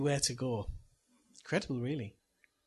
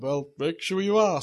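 slow, loud mock Santa; 2 semitones higher, 8 dB louder than usual. Disguised dad warning his kids, slow loud Santa. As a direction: drag it out in a big Santa voice. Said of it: "And I went".

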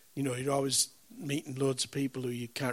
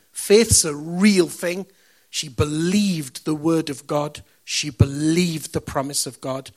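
rising preaching; 9 dB louder, slower than usual. Acted. Preaching faith at full force.

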